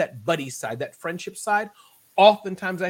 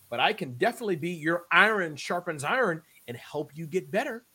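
ordinary talk, nothing marked

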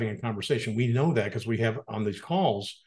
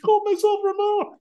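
high, laughing through the words; laughing disbelief. Real, not acted. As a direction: crack up in disbelief. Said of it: "Oh, my God".

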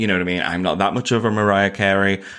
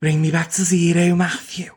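put-on Scouse accent; 8 semitones higher than usual. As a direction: throw the line back in thick Scouse. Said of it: "do Scouse with me".

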